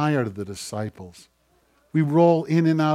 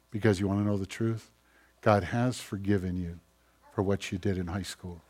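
much softer, intimate, gentle commands; tender quiet God voice; 4 semitones lower, 9 dB quieter than usual. Retold or imitated. God gently sending believers to the woman, soft and tender. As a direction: hush it like God leaning in close.

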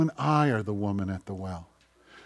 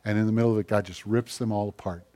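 usual speaking voice

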